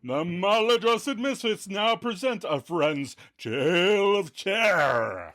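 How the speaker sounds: high, theatrical horror-show intro; spooky show announcer. Acted. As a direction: announce a tale of terror, stretching the last words. Announces a Halloween skit.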